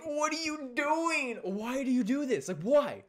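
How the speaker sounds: high, loud mock outrage; outraged disbelief. Camper reacting to Zach's bongo antics, outraged disbelief.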